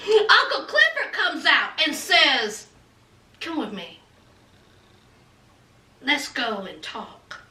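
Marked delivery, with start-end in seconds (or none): 3.4-7.4 very low, soft acted voice; hushed invitation. Uncle Clifford inviting him to the back room, hushed and low.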